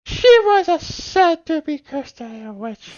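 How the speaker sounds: high, loud, slow hammed-up villain. Streamer to chat, hamming up a high villain voice. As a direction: stretch it out like a cackling villain. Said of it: "Seagull is pretty much a villain".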